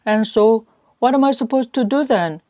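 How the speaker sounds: plain reading voice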